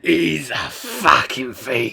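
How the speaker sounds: very slow, drawn-out Cockney film line; exaggerated slow London accent; Lock, Stock London accent. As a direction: imitate a London gangster accent, stretched out. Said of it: "Lock, Stock where they're talking and he's like".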